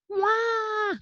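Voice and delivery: put-on hungry baby ghost wailing; baby crying. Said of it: "cried baby ghost".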